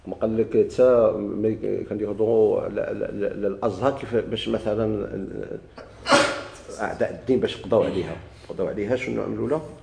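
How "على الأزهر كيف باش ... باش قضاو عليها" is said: ordinary talk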